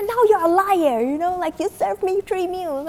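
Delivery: high, loud mock accusation; yelling customer. Customer calling attendant a liar, mock yelling. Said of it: "he was yelling at the top of his lungs".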